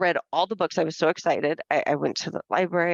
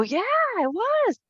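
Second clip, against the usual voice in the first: squeaky high "yeah, it was"; very high cartoonish clerk voice; sheepish clerks. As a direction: squeak it out like caught kids. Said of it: "They go".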